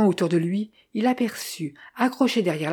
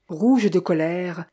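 ordinary delivery, nothing marked

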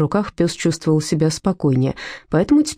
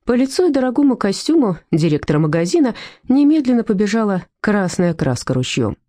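ordinary delivery

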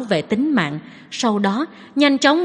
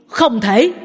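shouting; 4 dB louder than usual. A narrator's reading. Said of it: "Mạnh Trọng quát to một tiếng".

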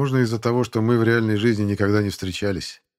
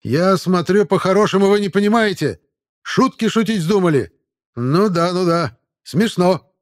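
loud, high barking sergeant; shouting drill sergeant; 9 semitones higher, 7 dB louder than usual. A narrator's reading. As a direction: bellow at the new recruits. Sergeant scolds the recruit.

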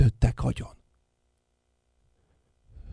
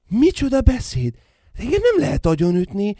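higher, louder, fast protest; indignant outburst; 8 semitones higher, 4 dB louder than usual. Acted. Son defying his father, indignant and loud. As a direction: fire it back in outrage.

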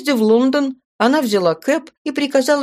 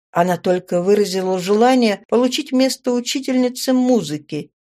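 ordinary delivery, nothing marked

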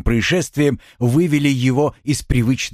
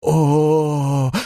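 long, higher-pitched moan; pained moan; 5 semitones higher than usual. A narrator's reading. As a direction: groan in weakness and terror. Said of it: "простонала Рита".

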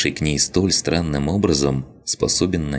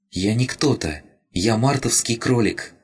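higher, small and plaintive creature voice; childlike rabbit spirit; 8 semitones higher than usual. Acted. Crystal rabbit tells Lina it was glad, higher childlike voice. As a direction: speak like a tiny lost spirit.

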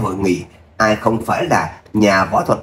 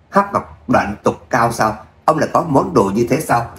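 ordinary delivery, nothing marked

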